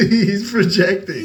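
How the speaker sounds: high and loud, slow through a laugh. Real, not acted. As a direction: laugh the words out slowly. Laughing while mocking the friend.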